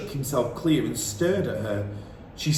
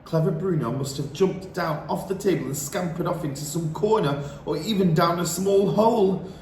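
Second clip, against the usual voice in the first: plain reading voice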